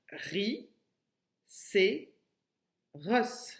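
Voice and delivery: "ri, c'est ros" in slow, separated syllables; long pause between each beat of "ri, c'est ros". Shown as a demonstration. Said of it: "No, c'est la deuxième".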